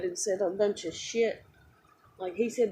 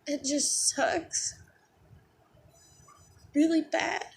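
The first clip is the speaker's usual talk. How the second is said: upset; 9 semitones higher, slower than usual. Real, not acted. Upset vlogger telling viewers it just sucks, high and slow. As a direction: voice rising high and slow on the hurt. Upset rant about being emotional.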